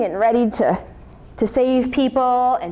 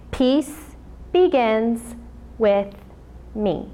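very slow, calm, fading at the end; slow meditative mantra; 3 dB quieter, much slower than usual. Shown as a demonstration. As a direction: draw each word out gently. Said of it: "this is how I meditate".

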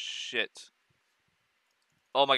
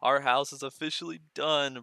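ordinary talk

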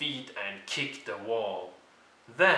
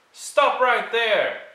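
high, loud, slow stop order; shouted command; 11 semitones higher, 8 dB louder than usual. Acted. George halting the children, loud and high. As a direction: bark it to halt them.

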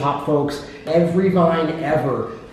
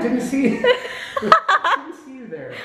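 laughing; 10 semitones higher, slower than usual. Real, not acted. Echoing joke, laughing.

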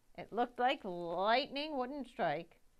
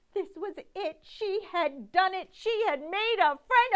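higher and louder with each line; building excitement; 10 semitones higher, 12 dB louder than usual. A narrator's reading. Narrator voicing Victoria's triumph, building excitement. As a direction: rise to a triumphant peak.

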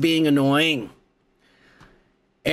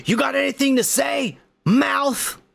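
Logan taunt; 8 semitones higher than usual. Retold or imitated.

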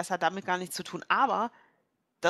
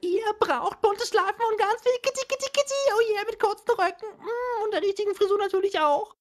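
put-on squeaky excited girl voice; high girly voice; 14 semitones higher, 4 dB louder than usual. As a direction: gush about fashion, high. Player voices character, high far.

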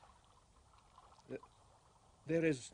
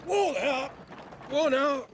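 high, loud call to rein in; calling out to horses; 11 semitones higher, 7 dB louder than usual. Acted. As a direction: shout the horses to a stop. Driver halting the horses.

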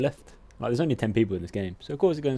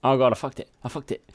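dismayed outburst; 3 semitones higher, 8 dB louder, faster than usual. Real, not acted. Reacting to a mistake.

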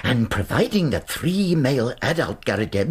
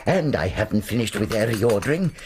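ordinary talk

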